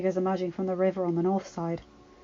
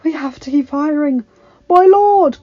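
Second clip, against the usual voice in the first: raised, forceful cry; urgent battle shout; 6 semitones higher, 10 dB louder than usual. Acted. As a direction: shout growing higher and louder. Bannerman calls to his lord in battle.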